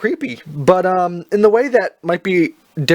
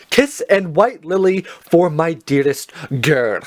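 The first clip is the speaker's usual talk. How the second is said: slow, careful, every word spelled out; over enunciating; slower than usual. Shown as a demonstration. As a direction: say the title slowly and clearly. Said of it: "let me say it slowly".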